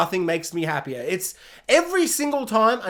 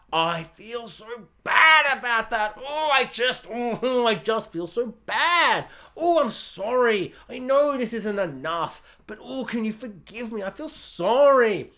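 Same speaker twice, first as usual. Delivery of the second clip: wallow in fake regret; whiny plea for forgiveness; mock remorse. Plays Negan's over-sorry apology.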